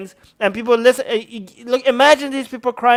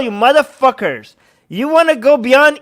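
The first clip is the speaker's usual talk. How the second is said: normal talking voice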